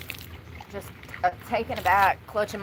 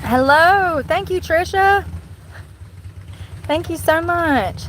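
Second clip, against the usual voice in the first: cheerful greeting; 7 semitones higher, 7 dB louder, much slower than usual. Real, not acted. Greeting passers-by warmly.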